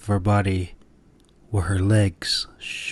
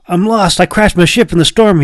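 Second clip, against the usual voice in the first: fast, higher and louder; fast, higher, hurried plea; said quickly; 8 semitones higher, 7 dB louder, faster than usual. Acted. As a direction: rush it out nervously. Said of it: "he said quickly".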